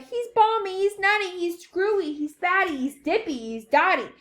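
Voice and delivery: chorus of shouting. A narrator's reading. Panicked parents raving about Wonka, shouting. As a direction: pile up the accusations, starting high. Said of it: "joined in the chorus of the frightening shouting".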